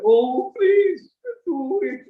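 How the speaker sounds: high, slow whiny plea; twisted begging voice. Shown as a demonstration. Teacher demonstrating kaku, a twisted begging tone. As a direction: bend the voice into a pleading whine. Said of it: "twisting his, uh, voice".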